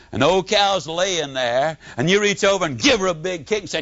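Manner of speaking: normal talking voice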